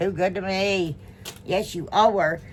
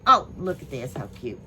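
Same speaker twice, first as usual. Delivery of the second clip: normal talking voice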